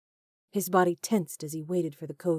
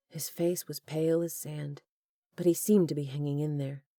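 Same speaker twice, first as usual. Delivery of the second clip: plain reading voice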